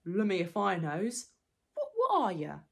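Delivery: loud, slow, puzzled troll voice; baffled troll. Acted. Troll questioning the creature he caught, baffled.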